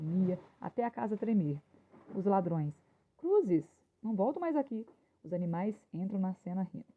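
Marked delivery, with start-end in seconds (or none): 3.2-4.9 higher-pitched exclamation, lightly hammed-up fright; acted alarm. Robber swearing never to return to the house, acted alarm. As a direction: cry it out as if scared off.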